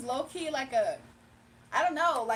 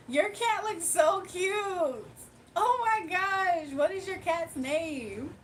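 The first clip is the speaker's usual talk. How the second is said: gushing over a cat; 5 semitones higher, 3 dB louder than usual. Real, not acted. Gushing over viewer's cat photo.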